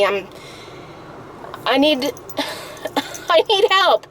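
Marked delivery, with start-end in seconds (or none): 1.7-4.1 real crying. Real, not acted. Upset woman recounting her doctor's visit, crying. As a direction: sob breaking into a higher pitch. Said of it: "Don't cry, Mommy".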